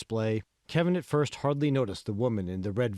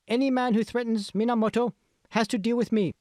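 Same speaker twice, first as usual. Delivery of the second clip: put-on very high, bright-eyed Japanese accent as a cosplay princess; strong Japanese accent; 12 semitones higher, 4 dB louder than usual. A narrator's reading. Cosplayer answers the reporter, in a high strong Japanese accent. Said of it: "Speaking with a strong Japanese accent".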